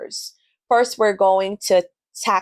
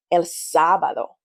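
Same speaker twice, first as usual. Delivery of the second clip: sábado with the stress on SA; stress on first syllable. Shown as a demonstration. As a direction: lean on the SA of sábado. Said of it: "there's an emphasis on the first syllable".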